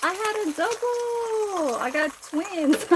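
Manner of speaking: high-pitched, thrilled squeal to the viewers; real excited discovery. Real, not acted. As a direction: show off a lucky find, voice way up. Finds a double one while cooking.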